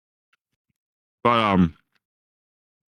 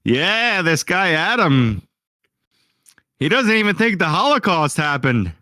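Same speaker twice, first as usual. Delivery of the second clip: higher, slow deadpan host; lightly voiced Norm, dry; Norm Macdonald impression; 8 semitones higher, slower than usual. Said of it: "he would be like".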